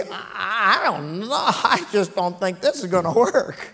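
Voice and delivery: lightly voiced whiny doubter, soft and falling; a hint of a doubting Israelite muttering; mock doubter. Preacher mimics a faithless Israelite complaining. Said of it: "if he'd let one word come out".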